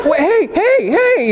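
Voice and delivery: high hammed-up protest; mock outraged protest. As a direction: yelp in protest as the game is stolen. Dad protesting game pause, retold.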